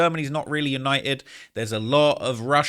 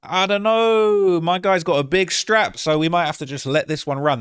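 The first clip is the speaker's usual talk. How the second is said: mock UN Security Council; 6 semitones higher, 7 dB louder than usual. Vlogger voicing the UN council dodging Trump, hammed-up high and loud. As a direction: ham up a nervous council shrugging it off. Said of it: "The United Nations Security Council said".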